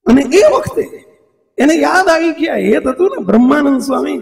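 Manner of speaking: ordinary delivery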